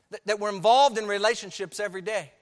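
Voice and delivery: high, loud pulpit fervor; full-force preaching. Acted. Preacher warns the congregation, loud and fervent. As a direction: drive it home to the church.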